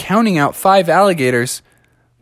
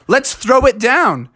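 higher-pitched, game-on retort; fired-up, slightly exasperated acceptance; taking up the challenge; 7 semitones higher, slower than usual. Acted. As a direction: rise to grandma's challenge. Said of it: "and he said".

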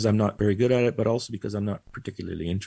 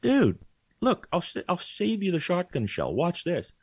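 voicing the dog; 6 semitones higher than usual. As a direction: play the dog talking back to his owner. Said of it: "he looks back at me and goes".

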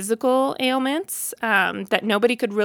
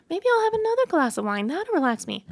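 much higher and faster, a bright hopeful rush; eager high inner voice; 8 semitones higher, faster than usual. Retold or imitated. To herself, reaching for more wine: high, fast, hopeful. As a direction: talk yourself into a second glass, high and quick.

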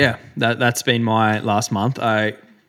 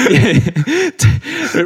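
ordinary delivery

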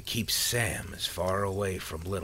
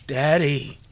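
put-on very loud, high child's scream; terrified scream; 8 semitones higher, 10 dB louder than usual. A narrator's reading. Boy clinging to his dying father, terrified scream. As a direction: scream out in terror for Daddy. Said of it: "Sam screamed in terror".

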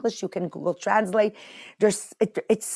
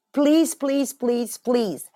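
pleading; 4 semitones higher, much slower than usual. Real, not acted. Begging listeners to share.

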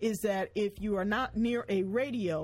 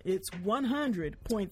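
usual speaking voice

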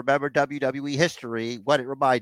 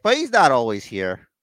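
lightly voiced Triple H, building higher and louder; a hint of a proud boss showing off; imitating Triple H; 6 semitones higher, 5 dB louder than usual. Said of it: "It's like".

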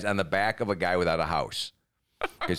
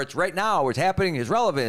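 higher, fast hyped pitch; mock hype; 8 semitones higher than usual. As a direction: sell it like a breathless promoter. Mocking pop-up show hype.

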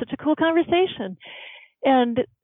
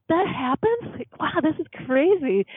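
amazed disbelief; 6 semitones higher than usual. Retold or imitated. Amazed reaction to helper news.

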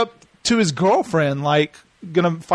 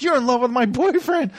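higher-pitched imitation of a quarrel; played-up indignation; mock jealous accusation; 6 semitones higher than usual. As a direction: act out a girl lashing out. Acts out girls' quarrel.

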